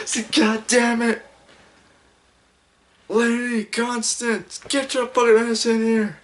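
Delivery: high, shouted angry dad voice, drawn out; angry father impression. Imitating an angry father yelling for Lady Constance, high and shouted. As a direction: bellow it like a furious cartoon dad.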